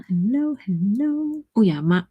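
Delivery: ordinary delivery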